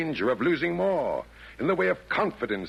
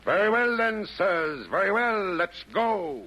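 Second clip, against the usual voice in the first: rallying cry; 7 semitones higher than usual. Acted. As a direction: fire up the troops. Chairman rallies the demons.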